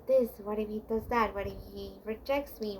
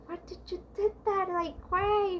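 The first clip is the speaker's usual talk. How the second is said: higher, tearful scolding of oneself; panicked self-reproach; 8 semitones higher, 3 dB louder than usual. Retold or imitated. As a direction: cry out at yourself in panic. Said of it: "and like".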